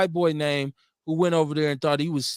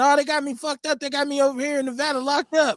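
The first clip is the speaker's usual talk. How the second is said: whiny plea; 10 semitones higher than usual. Retold or imitated. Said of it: "calling home to Wisconsin saying".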